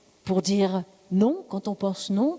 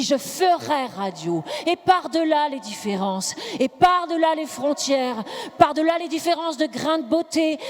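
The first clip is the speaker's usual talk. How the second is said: preach; 9 semitones higher, 4 dB louder than usual. Acted. Mock preacher rallying the congregation to cry for the radio, impassioned preaching. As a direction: build relentlessly into full-throated preaching, stretching the last cries.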